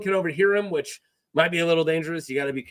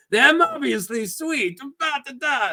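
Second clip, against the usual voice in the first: put-on high, experimental foreign pirate accent; trying out an accent; 8 semitones higher than usual. Narrator tests an accent for the pirate, high and exaggerated. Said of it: "what I'm gonna do with the accent".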